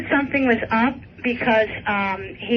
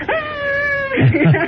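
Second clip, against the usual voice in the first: sudden high yelp of shock; surprised yelp. Retold or imitated. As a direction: yelp like hot tea just hit. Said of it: "And he's like".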